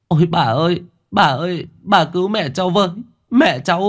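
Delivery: high, pleading, tearful cry for help; begging voice. A narrator's reading. She grabs a neighbour, begging them to save her mother, high and tearful. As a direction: beg the old woman desperately. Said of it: "giọng van vỉ".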